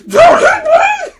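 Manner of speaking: very high, loud outraged screech; screaming little brother. Retold or imitated. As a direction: screech it like a boy mid-scuffle. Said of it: "And he's like".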